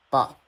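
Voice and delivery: "ba" short with a steep drop; harsh falling tone. Shown as a demonstration. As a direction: cut "ba" off hard, pitch falling. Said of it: "sounds a little bit harsh".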